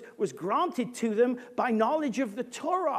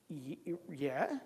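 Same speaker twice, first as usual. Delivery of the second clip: low, soft, stammered yeah; reluctant, much quieter and lower; hesitant reply; 8 semitones lower, 11 dB quieter than usual. Retold or imitated. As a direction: draw back warily, stumbling on it. Acts a wary, hesitant reply.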